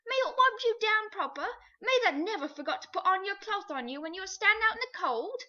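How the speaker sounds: high, loud, wounded pleading; a man begging his old friend to remember; hurt cabby. A narrator's reading. The cabby pleading with his horse, high and hurt.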